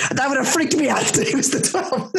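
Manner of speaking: nervous laugh. Real, not acted. Realizing the date could match, high nervous laughter. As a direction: laugh off the spooky coincidence.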